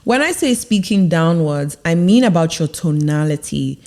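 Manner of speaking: ordinary talk, nothing marked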